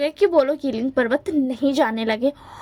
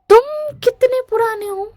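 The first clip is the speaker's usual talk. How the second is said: higher, louder sudden question; surprised question; 8 semitones higher, 5 dB louder than usual. Acted. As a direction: blurt it out, suddenly curious and higher. Wing fragt den Baumdämon aus.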